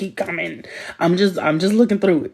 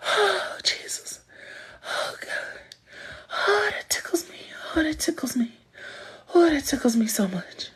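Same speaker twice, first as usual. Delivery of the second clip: breathless laughter; 9 semitones higher than usual. Real, not acted. Recovering from laughing fit.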